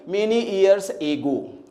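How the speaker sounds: very slow, "ago" as "AY-goo"; wrong pronunciation. Shown as a demonstration. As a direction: demonstrate the mistake, "ago" opening on "ay". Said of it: "pronunciation नहीं जानेंगे तो फिर क्या problem होगी". (धीमे में गलत उच्चारण, "ago" को "एगो")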